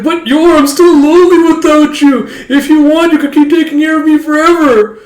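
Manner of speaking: very high, loud hammed-up plea; desperate wailing. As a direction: wail like a clingy little brother. Lonely brother pleads with sister.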